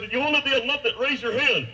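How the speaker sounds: high, lightly acted call to a crowd; convention announcer. Puppet quotes Muppet convention speaker, high-pitched call. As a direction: put it to the whole room. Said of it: "And he said".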